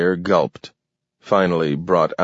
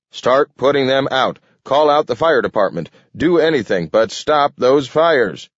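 high, loud frantic orders; yelling; 7 semitones higher, 4 dB louder than usual. A narrator's reading. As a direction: shout orders in panic. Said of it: "yelled Carter".

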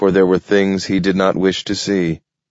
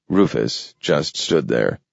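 plain reading voice

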